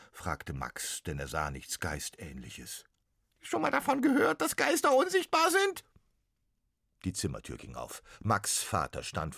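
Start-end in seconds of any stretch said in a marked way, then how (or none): 3.4-5.8 very high, squeaky teasing ghost; cheeky little ghost. Acted. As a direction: tease it as the tiny ghost. Ghost teases Max.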